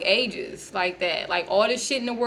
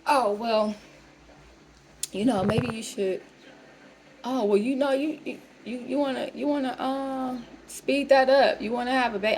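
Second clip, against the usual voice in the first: hesitant advice; 2 semitones higher than usual. Retold or imitated. Lady hesitantly advising a baby.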